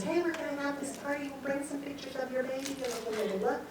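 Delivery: light, high social chatter; chatty girlfriend. Retold or imitated. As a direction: lightly imitate a friend's patter. Girlfriend's chirpy invitation acted out.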